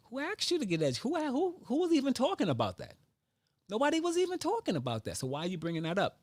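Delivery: squeaky high exasperated voice; high indignant mock. Scolding people who brag without education, high and indignant. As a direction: throw it back at them, high and fast. Mocking rant at bragging people.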